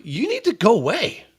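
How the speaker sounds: high, slow, insistent dismissal; exasperated plea. Retold or imitated. Man retelling how he sent the girl off, exasperated.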